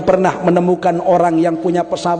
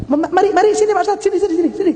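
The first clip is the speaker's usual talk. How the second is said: much higher, eager voice calling guests over; eager host inviting; 11 semitones higher, much faster than usual. Retold or imitated. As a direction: play the host waving guests to his table, pitched high. Quoting Pak Ismail inviting guests. (suara tinggi tuan rumah yang mengajak tamu)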